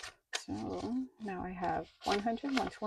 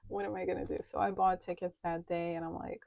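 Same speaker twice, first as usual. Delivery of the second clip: ordinary talk, nothing marked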